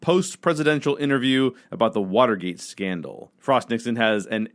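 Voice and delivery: normal talking voice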